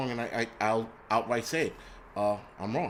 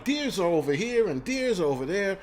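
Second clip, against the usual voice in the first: higher-pitched mock of his own blunder; clueless self-mockery; 6 semitones higher than usual. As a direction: mimic yourself blithely getting it wrong. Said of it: "like an asshole, I'm like".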